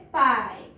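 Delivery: पाई with a drawn-out "eye" vowel; long I demo. Shown as a demonstration. Teacher showing how it reads, पाई with long I. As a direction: stretch the open vowel in पाई. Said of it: "जिस तरह हम पढ़ते हैं".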